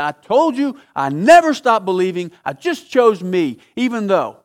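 captain losing patience, angrily insisting he never stopped believing; angry retort. Retold or imitated. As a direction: snap back at the preacher, fed up. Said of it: "the guy got mad at him".

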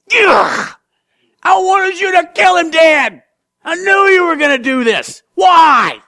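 high, loud furious cry of betrayal; outraged shout. Acted. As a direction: scream at God for sparing him.

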